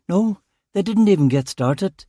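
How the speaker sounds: a flustered junior officer making excuses; upset young constable Lawson. Acted. Lawson voiced as a figure.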